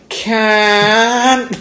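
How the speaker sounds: high, loud squeaky protest; neighbor's squeak. Retold or imitated. Caught neighbor squeaking a high loud refusal. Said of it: "He's like".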